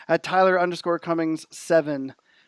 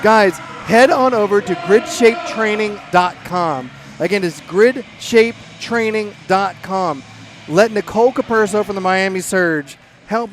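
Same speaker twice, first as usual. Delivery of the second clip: loud plug; 3 semitones higher, 5 dB louder, slower than usual. Real, not acted. Plugging the website over music.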